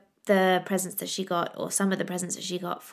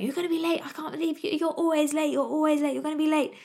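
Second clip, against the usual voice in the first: mock shouting husband; 8 semitones higher, faster than usual. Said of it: "He just kept shouting at me".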